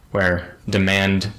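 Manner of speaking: normal talking voice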